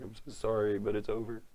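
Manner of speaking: tearful breakup voice, imitated; mock crying. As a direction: apologize through put-on tears. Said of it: "it's like crying".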